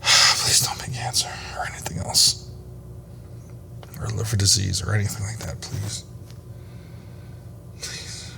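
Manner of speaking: hushed, breathy prayer for good news; whispered plea. Real, not acted. Praying alone before test results.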